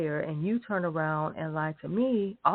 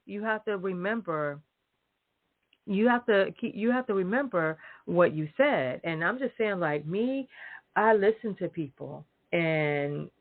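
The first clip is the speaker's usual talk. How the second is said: ordinary talk, nothing marked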